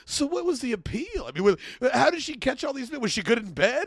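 lightly voiced probing reporter; hammed-up interviewer's questions; Keith Morrison. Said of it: "I love Keith Morrison so much".

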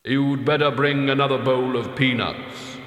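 heavy voice with a touch of resignation; sighed order. A narrator's reading. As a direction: give the order with a mild, weighty sigh. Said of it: "and sighed".

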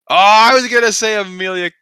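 high, loud groan of regret; frustrated outburst. Real, not acted. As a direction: kick yourself out loud for missing your pick. Beaten to his pick by another.

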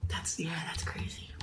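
a soft unvoiced whisper; whispered aside. Real, not acted. Reacting to her friend's remark, whispered aside. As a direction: drop to a breathy aside, barely voiced. Aside while dog licks.

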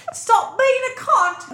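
hammed-up British dude telling her off, slowly; bad British accent. Imagining a British husband scolding her, in a bad British accent. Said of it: "I'm terrible with the English accent, the British accent".

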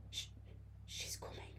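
barely managed, very soft whisper; frightened whisper. A narrator's reading. Girl warns her friend of the ghost, whispering. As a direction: whisper it haltingly, no voice at all. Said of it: "I managed to whisper".

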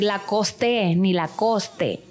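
LACOSTE with the final E sounded, "la-kos-TE" or "la-KOS-te"; pronunciación incorrecta. Shown as a demonstration. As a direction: misread LACOSTE the Spanish way, voicing the last E. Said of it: "no vas a decir". («Lacoste» mal dicho, con la e final)

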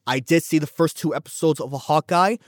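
ordinary talk

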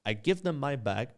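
ordinary talk, nothing marked

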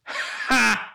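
sharp high mock "Ha!" right at her; one high derisive laugh thrown at mom; mocking laugh in her face. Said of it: "in my mother's face, went".

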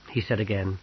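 ordinary delivery

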